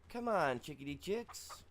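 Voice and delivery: high coaxing sing-song call, slow on chickadee chicks; calling chickens. Acted. Coaxing his chickens over, in a high sing-song call. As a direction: coo at the chickens like a farmer calling them in.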